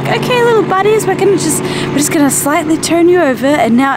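high gentle coaxing to turtles; sweet baby talk. Retold or imitated. Vlogger retelling how she gently released the turtles. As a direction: coo to them like little pets. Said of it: "And I was just like".

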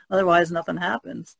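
normal talking voice

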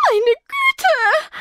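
high, loud squeak of shock. A narrator's reading. Rebecca shocked at being the best chance, squealing high. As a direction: squeal it out in alarm. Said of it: "quietschte Rebecca".

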